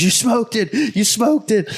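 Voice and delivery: high-pitched excited mock yell; retold yelling. Guide cheering the hunter's shot, retold yelling. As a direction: re-enact a jubilant holler, voice jumping way up. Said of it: "I was yelling at the top of my lungs".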